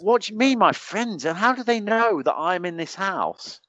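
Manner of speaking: high-pitched retold bewilderment; re-enacted astonishment, pitch jumping up; baffled disbelief. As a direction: demand an explanation, utterly thrown. Retold baffled reply to surprise visitor.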